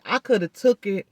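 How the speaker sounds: ordinary talk, nothing marked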